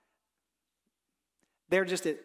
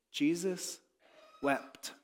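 hushed solemn; 2 semitones lower, 6 dB quieter, much slower than usual. Acted. Preacher reading the shortest verse to the congregation, hushed and solemn. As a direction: let the verse sink in quietly.

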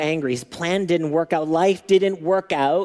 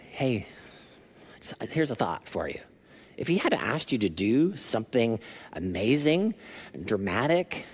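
softer and lower, coaxing; gentle servant; 4 semitones lower, 5 dB quieter than usual. Retold or imitated. Servant quietly coaxing his angry master, soft and careful. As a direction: ease a suggestion in carefully. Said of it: "waited till Naaman calmed down".

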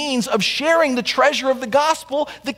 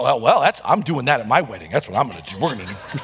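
mock groom; 11 semitones lower, faster than usual. Pastor mocks a groom haggling over vows, low goofy voice. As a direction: play a clueless groom proudly bargaining his vows. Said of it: "No one would go".

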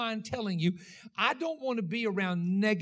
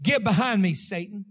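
stern rebuke; 7 dB louder than usual. Acted. Jesus rebukes Peter.

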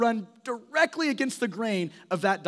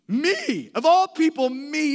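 higher, louder stress on "Me"; great emphasis; 6 semitones higher, 5 dB louder, slower than usual. Shown as a demonstration. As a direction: punch "Me" with wonder. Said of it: "Read those words with great emphasis".

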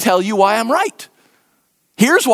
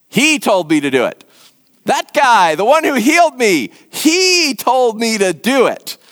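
HE stretched and punched, pinning it on him; indignant emphasis on HE; blame-shifting; 2 semitones higher than usual. Acted. Healed man shifting the blame.

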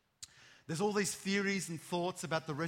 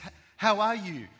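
loud, high, bright-eyed greeting; hammed-up cheery how are you; mock chirpy greeting; 4 semitones higher, 11 dB louder than usual. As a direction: gush a fake friendly hello.

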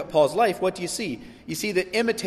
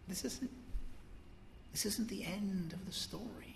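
soft reassurance; 4 semitones higher, 17 dB quieter than usual. Pretend.

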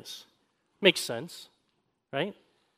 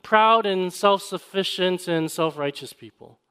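raised, louder preacher voice; preaching; 6 semitones higher, 4 dB louder than usual. Acted. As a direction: build it up from the pulpit. Preaching at full force.

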